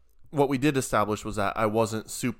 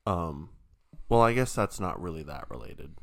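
ordinary talk, nothing marked

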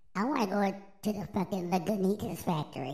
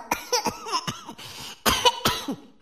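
harsh hacking cough fit; smoker's cough; 4 dB louder than usual. Real, not acted. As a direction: cough hard after a big hit. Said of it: "Give me a second".

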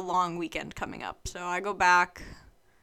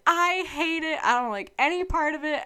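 high-pitched imitation of a tearful outburst; mock sobbing; 8 semitones higher than usual. Student losing it to dad about school, mock sobbing. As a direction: retell your own meltdown in a much higher voice. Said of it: "was sobbing".

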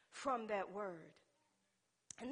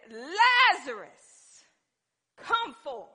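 loud, long-drawn summons; commanding call; 5 semitones higher, 18 dB louder, much slower than usual. Acted. Preacher as Jesus calling the dead man out, drawn-out shout. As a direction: call out the name slowly and powerfully. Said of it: "Jesus began to speak to death".

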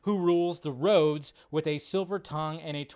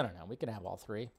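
resigned mutter; 8 semitones lower, 14 dB quieter, faster than usual. Real, not acted. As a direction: lower and much softer, defeated.

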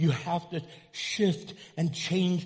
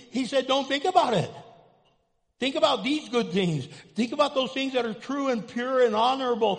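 raised, louder preacher voice building to God's words; preaching; 6 semitones higher, 5 dB louder than usual. Acted. Pastor preaching to the church, loud and fervent. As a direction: preach it out to the congregation.

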